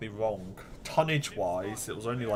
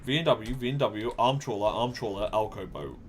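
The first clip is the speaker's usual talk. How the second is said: normal talking voice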